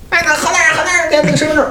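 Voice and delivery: high mock call of townsfolk; lightly voiced townsfolk shouting; imitating locals.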